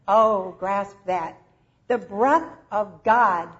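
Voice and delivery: ordinary talk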